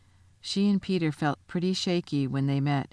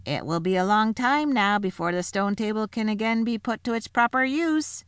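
a sly little dwarf speaking up; 6 semitones higher than usual. A narrator's reading.

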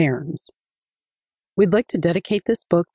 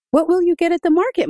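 put-on high, quick child's voice, asking excitedly; eager little boy; 12 semitones higher, 4 dB louder, faster than usual. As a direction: rush the question like an excited boy. Adika asks Mama eagerly.

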